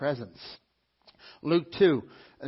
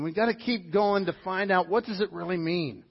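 normal talking voice

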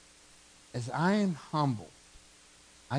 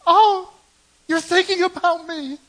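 much higher, louder gushing mock wife voice; imitating wife; 12 dB louder than usual. Preacher imitating his wife thrilled by a small gift, high mock gush. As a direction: squeal it like a delighted wife.

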